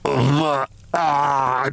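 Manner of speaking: choked gasping cry like a drowning man; choking gasp. A narrator's reading. Briggs choking on the camphor, drowning gasp. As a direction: gag and gasp for breath. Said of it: "choked Briggs like a drowning man".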